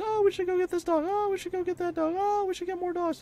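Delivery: very high mock wife voice. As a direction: squeal it like the excited wife begging. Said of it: "And she sees all these dogs".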